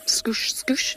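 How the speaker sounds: whispered scoosh, scoosh like a spray of scent. Shown as a demonstration. Guide mimicking a leopard's scent spray, whispered. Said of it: "as we say".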